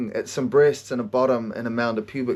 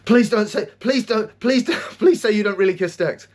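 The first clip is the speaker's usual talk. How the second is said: put-on louder, higher begging kid; a kid pleading with his mum, higher and louder; desperate pleading; 6 semitones higher, 3 dB louder than usual. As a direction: beg her not to have said it. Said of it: "I'm like".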